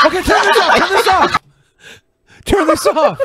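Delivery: high-pitched plea to stop; squeamish protest. Real, not acted. Viewer pleading to stop a gross video, squeamish protest.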